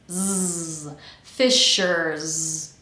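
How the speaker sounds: "fishers" ending in a long buzzing Z; vibrating Z. Shown as a demonstration. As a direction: buzz the final S of FISHERS as Z. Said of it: "that S should be pronounced vibrating".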